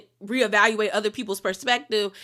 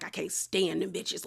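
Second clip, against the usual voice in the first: mock sour grumble, slow and much softer; attitude; 5 semitones lower, 9 dB quieter than usual. Tamara sulking in the kitchen, slow soft grumble. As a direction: mutter it with a mean mug. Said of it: "she has an attitude".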